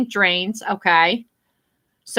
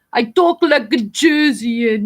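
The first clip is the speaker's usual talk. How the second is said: drawn-out, exaggerated Jersey accent; mock Jersey accent; slower than usual. Streamer jokes about how people hear her talk, mock Jersey accent. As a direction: lay on a slow Jersey accent. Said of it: "It's a Jersey accent".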